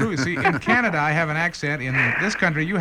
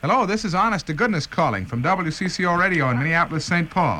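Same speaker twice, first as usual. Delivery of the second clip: bright, raised on-air host greeting; radio announcer; 5 semitones higher than usual. Acted. Radio host calling a listener at home, upbeat announcer voice. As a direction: announce it like a live radio broadcast.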